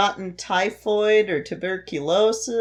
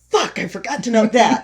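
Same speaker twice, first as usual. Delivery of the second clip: higher, louder retold curse; mock frustrated outburst; 5 semitones higher, 5 dB louder than usual. Sick script writer missing another note, louder mock frustration. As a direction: escalate the self-reproach, half amused. Repeats frustrated self-talk, escalating.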